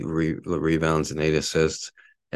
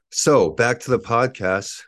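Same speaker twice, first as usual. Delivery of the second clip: ordinary talk, nothing marked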